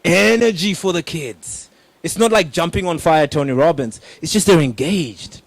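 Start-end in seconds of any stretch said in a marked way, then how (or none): none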